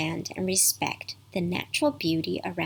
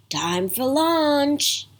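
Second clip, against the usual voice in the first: slow, louder call across the pond; 6 semitones higher, 4 dB louder, much slower than usual. Acted. Grandfather calling Mandy in from the water, drawn out. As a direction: sing it out so she hears.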